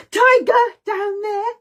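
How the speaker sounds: high, loud, slow alarm; alarmed cry. Pretend. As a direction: warn of a tiger in panic. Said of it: "We go".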